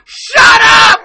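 very high, very loud yell; mock furious shout. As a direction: scream it as an irritated creature. Acts out annoyed Shrieker yelling.

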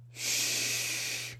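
soft breathy shh; shushing. Acted. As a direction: hush someone gently. Hushing at the poem's end.

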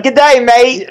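mock Aussie "Good day, mate"; lightly hammed Australian greeting; imitating an Australian singer on a talk show; Australian accent. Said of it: "she was like".

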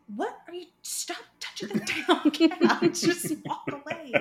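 loud, high, half-laughing telling-off; laughing scold. Retold or imitated. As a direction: scold him while cracking up. Retold scolding him over cat.

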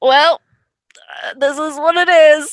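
a hammed-up tearful shrug; mock-tearful this is what it is; mock resigned whimper. Resigned self-quote.

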